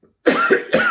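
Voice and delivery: hammed-up cough after a forced breath; deliberate loud cough. Shown as a demonstration. Teacher shows what dramatic breathing does, a loud fake cough. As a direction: cough loudly as if choked. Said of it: "it made me cough".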